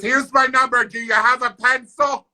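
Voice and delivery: lightly voiced chatty neighbor; a hint of a bubbly lady asking. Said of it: "So she goes".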